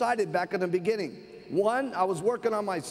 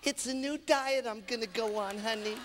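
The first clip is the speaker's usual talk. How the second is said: soft, placating wife's voice; timid, hushed explanation; meek wife; 5 dB quieter than usual. Acted. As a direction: answer the husband quietly and sweetly. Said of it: "And she goes".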